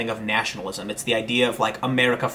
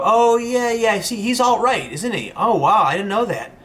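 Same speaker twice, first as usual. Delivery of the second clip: higher, mock-surprised, lightly caricatured; second journalist agreeing over drinks, mock-amazed and raised; mock gullible journalist; 8 semitones higher than usual. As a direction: play a colleague swallowing the rumour whole. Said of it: "another person will go".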